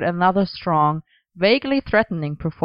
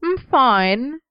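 put-on childish pout; 7 semitones higher, 3 dB louder than usual. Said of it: "frowned, like a child".

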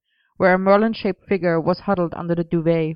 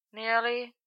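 soft, higher, tired reply; small, drained answer; weary; 6 semitones higher, 9 dB quieter than usual. A narrator's reading. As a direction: barely give it any volume. Exhausted Merlin answering on phone.

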